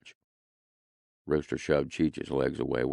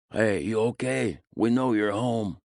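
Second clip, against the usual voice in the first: put-on high, slow call in a Spanish accent; a lightly done Spanish accent, calling out; heavy Spanish accent; 7 semitones higher, 4 dB louder than usual. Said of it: "a guy with a heavy Spanish accent said".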